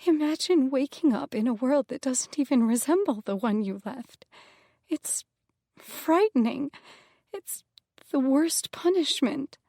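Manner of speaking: a hint of a tremble, rising on the key words; quivering voice. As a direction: let the voice quiver with fear. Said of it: "her voice quivered".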